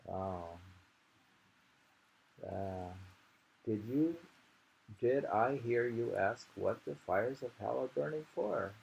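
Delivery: normal talking voice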